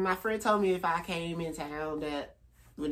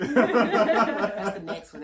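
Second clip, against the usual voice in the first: laughing; 4 semitones higher, 7 dB louder, much slower than usual. Real, not acted. Laughing deadpan joke.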